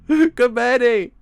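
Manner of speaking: loud, high, drawn-out wail of the name; high wailing shout; anguished exclamation. Real, not acted. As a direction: cry out slowly for the poor character. Exclaims over hapless character.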